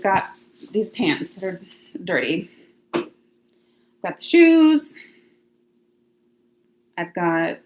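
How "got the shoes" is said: loud, high, stretched out showing off; proud slow reveal; excited. Real, not acted. Showing the viewers her shoes, loud proud drawl.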